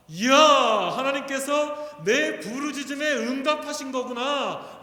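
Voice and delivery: loud, high cry of amazement; exclaiming in awe. Retold or imitated. Preacher voices Samson seeing the spring, exclaiming in awe. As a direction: cry out as if the prayer was just answered. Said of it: "라고 하면서".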